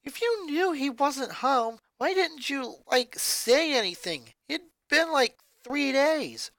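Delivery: irritated. Acted. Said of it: "Lenny was genuinely irritated".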